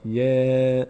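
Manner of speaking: "یه" held long with a front æ; thin, light vowel. Shown as a demonstration. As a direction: hold "یه" on a level note, light vowel. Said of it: "پر خونده نمیشه".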